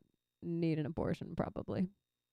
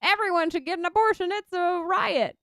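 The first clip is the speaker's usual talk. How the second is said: put-on gleeful endorsement, pitched up; mock enthusiasm; 8 semitones higher, 3 dB louder than usual. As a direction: gush like an over-keen advocate, in jest. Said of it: "there's nobody out there like".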